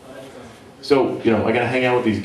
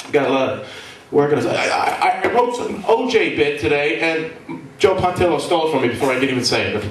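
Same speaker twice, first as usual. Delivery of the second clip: ordinary talk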